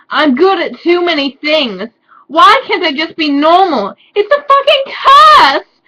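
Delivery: mock tragic scream at the curse; hammed-up shout. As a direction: wail it like a drama queen. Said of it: "I shouted".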